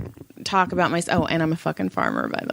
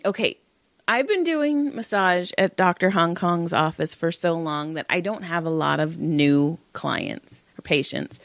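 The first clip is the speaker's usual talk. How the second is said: normal talking voice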